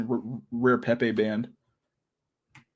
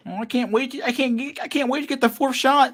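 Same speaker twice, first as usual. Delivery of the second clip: much higher, gushing mock voice of a proud vaccine fan; mock eager booster fan; 12 semitones higher than usual. Streamer mocking people who post booster shots, high gushing parody voice. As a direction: ham up the giddy fan who can't wait for more shots. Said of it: "They're so proud, dude".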